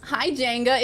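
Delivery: ordinary delivery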